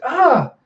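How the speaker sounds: put-on short high girl's shriek; mock yelp of fright; startled scream. [name] reacts to the attack.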